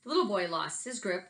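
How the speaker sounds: lively picture-book read-aloud with big swings; reading to children. Acted. Teacher reading a picture book to her class, lively. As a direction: tell it like a story hour for kids.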